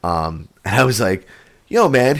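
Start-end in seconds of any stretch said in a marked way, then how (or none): 1.7-2.2 high, loud surprised hey; a raised, startled yo; excited greeting. Retold or imitated. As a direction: greet him, caught off guard. Greeting the giant therapist.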